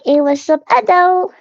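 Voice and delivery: high sing-song little hello; cutesy hello. Pretend. Streamer greeting chat in a cutesy high voice. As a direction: chirp it like a tiny cartoon girl. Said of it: "I'm like".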